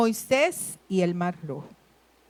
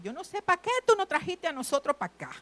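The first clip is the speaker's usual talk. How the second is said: desperate complaint; 6 semitones higher, 4 dB louder, faster than usual. Retold or imitated. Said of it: "ellos le empiezan a decir a Moisés".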